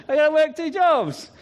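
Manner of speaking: high, animated character voice; imitated Malawian guard. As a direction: answer as the guard, pitched well up. Voicing the guard again.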